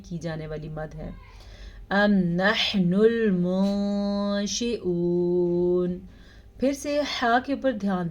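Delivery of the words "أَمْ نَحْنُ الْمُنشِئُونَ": slow melodic tajweed chant; measured Quranic recitation. Shown as a demonstration. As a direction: recite slowly, drawing out each vowel. Recitation demo.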